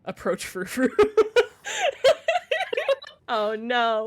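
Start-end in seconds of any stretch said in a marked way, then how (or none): 0.9-3.2 high, loud cat hiss imitation. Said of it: "why hiss at me?".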